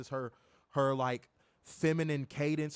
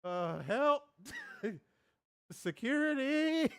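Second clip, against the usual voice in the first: mock cry for help; 7 semitones higher, slower than usual. Pretend call for help.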